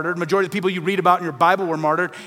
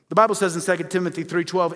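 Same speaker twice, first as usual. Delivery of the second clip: usual speaking voice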